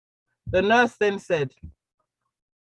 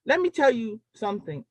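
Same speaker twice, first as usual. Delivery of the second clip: ordinary talk, nothing marked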